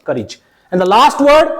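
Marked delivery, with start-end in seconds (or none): 0.1-0.4 courage said as KUH-rij; short i ending. Shown as a demonstration. Teacher repeating the correct form, courage as kuh-rij. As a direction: end courage in a short rij, not rayj. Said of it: "not courage".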